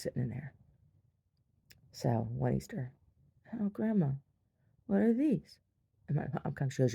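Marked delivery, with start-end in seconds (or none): 3.6-5.6 put-on high-pitched child's question; curious little girl. Little girl asking Grandma at Easter, high and curious. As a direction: play your younger self, voice lifted.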